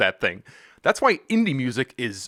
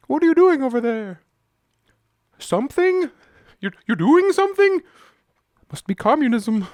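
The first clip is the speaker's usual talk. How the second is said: high, louder put-on voice of suspicion; hammed-up suspicious busybody; mock suspicion; 12 semitones higher, 5 dB louder than usual. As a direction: pitch it way up, prying and suspicious. Acts out a suspicious nosy onlooker.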